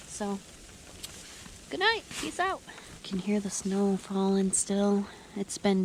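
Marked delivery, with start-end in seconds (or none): 1.7-2.6 singsong goodnight. Real, not acted. Cheery sign-off before sleeping.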